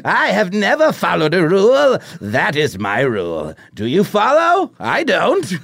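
high, loud taunting clown; Joker voice. Acted. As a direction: sneer it out like a clown villain. Joker speaks in read script.

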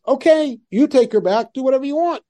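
Okay tossed off high and light as a quick brush-off; mock shrugging dismissal. Avram shrugging off Sarai voiced.

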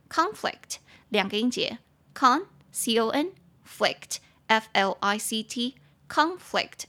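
"Conflict" with the weight on "con", spelled out slowly; stress on CON. Shown as a demonstration. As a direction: say "Conflict" leaning hard on the first syllable "con". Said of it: "重音节在第一音节".

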